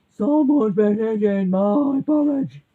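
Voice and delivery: very loud, slow bear roar; roaring daddy bear. A narrator's reading. Daddy bear finding his porridge eaten, roaring slowly. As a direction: boom it out slowly like an outraged bear. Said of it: "And the daddy said".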